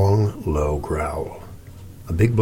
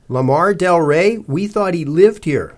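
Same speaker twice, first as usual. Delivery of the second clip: slow, high, raised voice; patient repeat; 8 semitones higher, 4 dB louder, slower than usual. A narrator's reading. Cece repeating the name for the lady, patient. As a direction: spell it out slowly and loudly.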